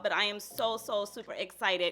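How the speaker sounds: ordinary delivery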